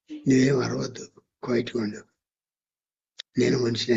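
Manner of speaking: low and hushed, confiding; retold quiet reassurance to hush up; softly spoken aside. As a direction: act out a secret told into an ear. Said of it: "నెమ్మదిగా చెప్తున్నాడు".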